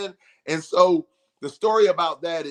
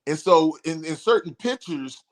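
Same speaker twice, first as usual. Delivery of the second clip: ordinary talk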